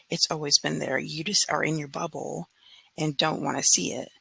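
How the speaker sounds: ordinary talk, nothing marked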